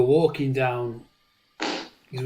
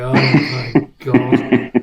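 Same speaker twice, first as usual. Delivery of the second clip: slow drawn-out oh my God; stunned; 8 dB louder, much slower than usual. Retold or imitated. Dad watching his son wee like a fire hose, slow stunned. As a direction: let the shock stretch out. Shocked at the torrent.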